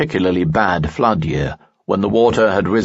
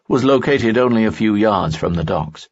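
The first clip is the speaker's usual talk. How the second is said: usual speaking voice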